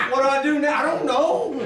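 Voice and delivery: high, loud mock fluster; comic panic. Customer asked to guess a shirt's price, comically panicked.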